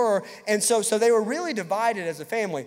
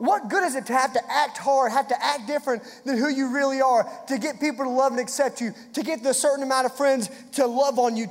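higher, driving preacher's refrain; preaching; 4 semitones higher than usual. Acted. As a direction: press the question home to the young people. Preaching climax, repeated challenge.

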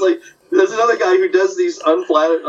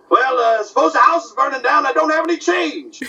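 Rochester voice=145; 11 semitones higher than usual. Retold or imitated. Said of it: "doing Benny and Rochester".

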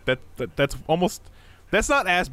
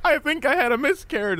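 mock girlfriend voice; 13 semitones higher than usual. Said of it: "where they're like".

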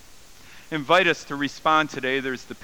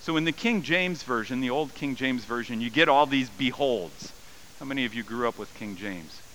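usual speaking voice

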